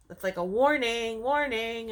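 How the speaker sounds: plain reading voice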